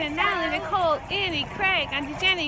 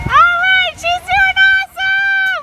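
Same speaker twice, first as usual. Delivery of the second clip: cheering shout; 11 semitones higher than usual. Real, not acted. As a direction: shout to a friend in the race. Cheering a runner she spots.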